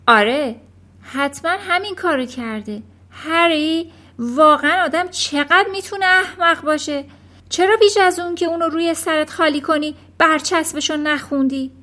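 loud, indignant wife's lecture; exasperated scolding. A narrator's reading. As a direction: berate your husband for his stupidity. Mother scolds foolish husband.

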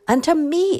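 loud, high retort; yelling back. A narrator's reading. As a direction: yell it back to correct her. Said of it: "Imogene yelled back at her".

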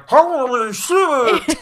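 hugely drawn-out, high mock cry; imitated shocked exclamation. Host mimicking the film character's line, hammed-up slow shock. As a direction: stretch it into a hammed-up wail of disbelief. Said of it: "He actually says that, like".

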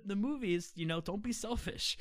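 normal talking voice

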